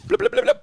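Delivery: quick bubbly "blip" sound effect; playful run of blips; imitated opening noise. Shown as a demonstration. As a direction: mouth the noise of a zip opening. Imitating a package being opened.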